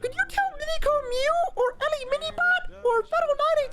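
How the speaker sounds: put-on very high, squeaky child voice; hammed-up tiny kid begging a favor; mock pleading kid. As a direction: squeak out an eager fan's request in falsetto. Mimics niece and nephew's fan request.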